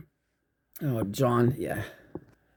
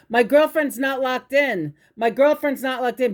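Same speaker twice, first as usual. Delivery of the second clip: frantic screaming; 8 semitones higher than usual. Retold or imitated. Boyfriend screaming that his girlfriend isn't strapped in, mock frantic yell. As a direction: imitate a frantic man calling for help. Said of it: "He's screaming".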